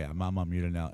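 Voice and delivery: normal talking voice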